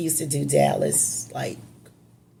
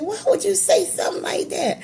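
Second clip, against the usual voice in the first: retold, much higher disbelief; shocked question; faster than usual. Daughter on the phone alarmed by father, shocked. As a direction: ask again in alarm. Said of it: "I said".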